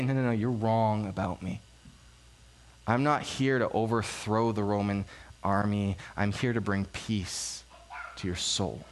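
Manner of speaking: low, calm voice, slowing and softening; gentle correction. Retold or imitated. Jesus gently correcting the crowd.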